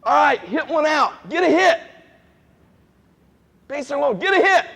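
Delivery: high, loud yell at the batter; acted sideline shouting, peaking on the command; mock coach shouting. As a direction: holler it like an over-eager baseball coach. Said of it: "very easy for us to say".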